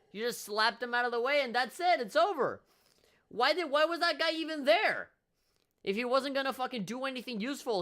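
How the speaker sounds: loud, high-pitched disbelief; exasperated rant. Real, not acted. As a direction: rant in outrage at the letdown. Ranting about the anticlimactic boss fight.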